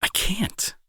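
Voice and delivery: whispered, voice catching; choked whisper. Acted. Admitting to the friend he can't ignore them, choked whisper. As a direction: barely get the words out. Tender promise to struggling friend.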